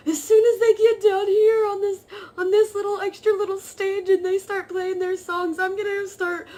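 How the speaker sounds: high, loud, mock-dramatic joking. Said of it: "like, jokingly saying that".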